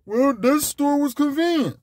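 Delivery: high dumb excuse; ignorant voice. Retold or imitated. Mocking a shopper who spends anywhere, high ignorant voice. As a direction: shrug it off like a clueless shopper. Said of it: "there go my ignorant voice".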